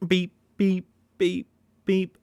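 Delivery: high bleeping beep sound over a swear; mock censor beep. Covering a curse, a high censor beep. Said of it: "censoring own word".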